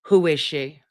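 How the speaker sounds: ordinary talk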